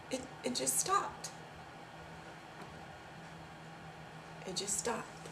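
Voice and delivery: very soft, stunned retelling; stunned and quiet after the panic; hushed aftermath. Acted. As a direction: drop to a near-whisper, with long pauses.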